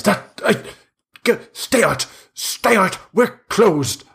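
high, frantic stay-out plea; panicked refusal. Acted. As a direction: shoo them away in panic. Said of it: "he says".

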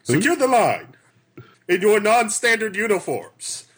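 high, loud command to the crew; captain calling out orders. Acted. Captain orders men to secure the line, calling out. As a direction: shout it as an order across the deck. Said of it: "I gesture for my men".

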